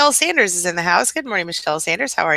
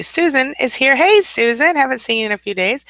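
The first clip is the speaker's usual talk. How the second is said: high, loud happy hello; excited greeting; 8 semitones higher, 4 dB louder than usual. Real, not acted. Host spots a returning viewer, a high, loud excited hello.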